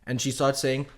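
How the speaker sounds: ordinary talk, nothing marked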